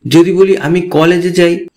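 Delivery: ordinary talk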